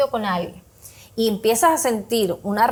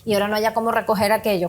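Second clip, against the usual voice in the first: fast regretful muttering; faster than usual. Retold or imitated.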